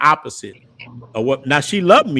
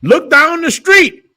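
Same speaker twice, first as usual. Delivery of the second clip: much higher, louder and slow, spelled out; raised voice; 8 dB louder, slower than usual. Retold or imitated. Father ordering his son down the street, raising his voice. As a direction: bellow it slowly like an angry father. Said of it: "His voice started raising".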